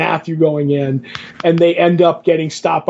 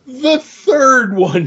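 THIRD ONE drawn out slowly and much higher; suggestive emphasis; 8 semitones higher, much slower than usual. Real, not acted. Telling co-host Joe about the panels, suggestive emphasis on "The third one". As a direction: stretch "The third one" with a high, knowing lift.

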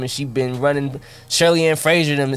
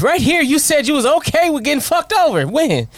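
mock confrontation; 11 semitones higher than usual. Acted boss and player exchange.

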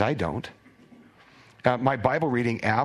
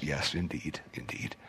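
whisper; 9 semitones lower, 10 dB quieter than usual. Real, not acted. Whispered agreement aside.

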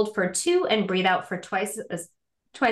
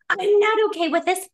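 higher, louder frantic plea to a partner; frazzled plea; 8 semitones higher, 4 dB louder than usual. Retold or imitated. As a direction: blurt it out, overwhelmed but loving. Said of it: "to just be like".

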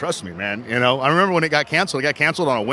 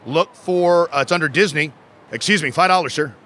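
ordinary talk

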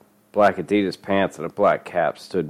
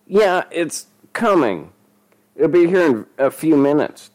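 fumbling excuse; 9 semitones higher, 4 dB louder than usual. A narrator's reading. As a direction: stall anxiously in a high voice. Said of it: "Denny fumbled".

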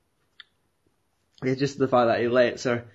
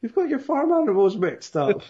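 much higher put-on voice, teasing; mocking retort; 10 semitones higher than usual. Speaker imagining telling the doctor off, in a high mocking voice. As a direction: squeak out the correction, mocking. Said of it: "but it's like".

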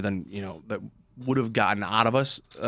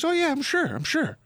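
mock falsetto guard. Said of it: "So the guy goes".

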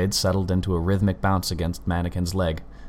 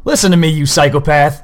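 shouted, much higher and louder; furious shout; 8 semitones higher, 9 dB louder than usual. A narrator's reading. Colin screaming at the killer, furious. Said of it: "the outbursts".

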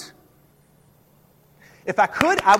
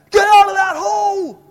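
shouted drill sergeant bark; 11 semitones higher, 10 dB louder than usual. Retold or imitated. Drill sergeant yelling at recruit.

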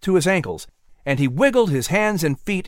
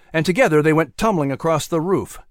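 ordinary delivery, nothing marked

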